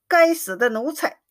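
plain reading voice

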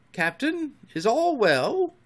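high, tentative question; timid. A narrator's reading. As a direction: ask the captain gingerly. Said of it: "they asked timidly".

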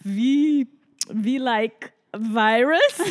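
slow, loud flustered English; imitating herself stumbling over English; mock flustered self. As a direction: replay the panicked phone call. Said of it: "Und ich nur so".

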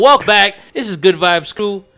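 loud, high, booming welcome; big announcer intro. Pretend. Host restarting the podcast intro, loud and hyped. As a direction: belt out the show's name like a hype host.

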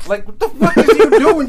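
shouted through a high laugh; laughing yell of disbelief; laughing outburst. Real, not acted. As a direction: crack up loud in disbelief. Laughing at friend's gesture.

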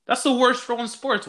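high, emphatic outburst; indignant rant. Real, not acted. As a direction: declare it the worst, fired up. Ranting about the six foul rule.

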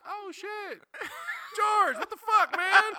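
put-on high, loud delighted shout at an old friend; excited greeting. Retold call from old friend.

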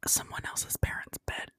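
hushed confession in a whisper; voiceless, secretive whisper. Real, not acted. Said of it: "why am I whispering like this".

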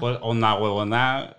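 lightly voiced Venezuelan accent, slow; slow, hesitant Venezuelan accent; Venezolano accent attempt. Shown as a demonstration. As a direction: try the Venezuelan slang in a Venezuelan accent. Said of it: "la palabra que más te gusta venezolana".

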